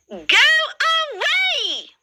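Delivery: very high, snapping dismissal; ugly sisters' voice. A narrator's reading. Ugly sisters telling Cinderella to go away, high and snappy. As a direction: snap it up high. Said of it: "say the ugly sisters".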